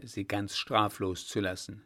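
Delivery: plain reading voice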